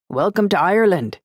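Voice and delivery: high, loud, hearty greeting; hearty welcome. A narrator's reading. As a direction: boom out a big friendly welcome. Hearty greeting after a laugh.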